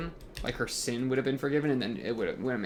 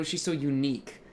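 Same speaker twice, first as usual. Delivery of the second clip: normal talking voice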